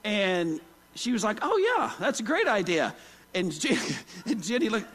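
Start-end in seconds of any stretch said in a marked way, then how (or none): 1.4-3.0 bright, over-eager mock agreement, pitch jumping up on oh yeah; faked enthusiasm. Wife faking delight at the host's blood gravy idea, overdone cheer. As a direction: gush to cover that you hate the idea. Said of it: "the faking it was over".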